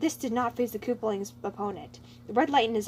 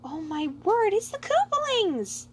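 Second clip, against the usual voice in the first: high, slow mock delight; fake joyous surprise; 10 semitones higher, 5 dB louder, slower than usual. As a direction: put on false joyful surprise. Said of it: "smile of joyous surprise".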